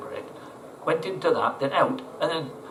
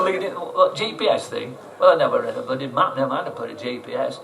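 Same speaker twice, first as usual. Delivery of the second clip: ordinary talk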